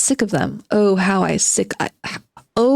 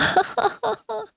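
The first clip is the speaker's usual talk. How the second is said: high laugh; 14 semitones higher, 5 dB quieter than usual. Real, not acted. Laughs at own joke.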